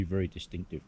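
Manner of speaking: ordinary talk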